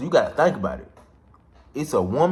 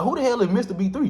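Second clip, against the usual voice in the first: much higher, baffled question; shocked; 11 semitones higher than usual. Retold or imitated. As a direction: ask like you've never heard the name. Confused denial to police.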